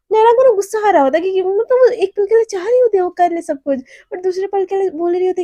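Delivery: animated explaining. Real, not acted. Storyteller gets worked up describing Naina, animated. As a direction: get worked up telling it.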